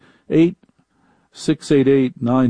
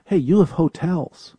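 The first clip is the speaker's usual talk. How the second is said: usual speaking voice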